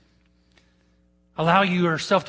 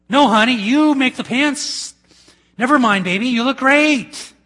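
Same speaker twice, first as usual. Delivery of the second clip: flattering husband; 8 semitones higher, 6 dB louder than usual. Acted.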